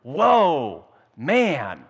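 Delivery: loud, high, stunned exclamation; amazed whoa. Acted. Adam waking and seeing the woman, loud amazed whoa. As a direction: gasp it out like a man seeing a miracle. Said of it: "and he says".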